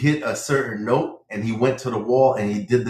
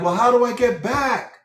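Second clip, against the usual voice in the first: high worried question; 11 semitones higher than usual. Retold or imitated. Man asks intercom voice.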